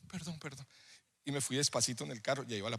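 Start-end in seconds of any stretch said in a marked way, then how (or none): 0.1-0.7 very soft, muttered apology; sheepish apology. Acted. Youth pastor caught looking at girls in the mirror, sheepish. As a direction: apologize under your breath.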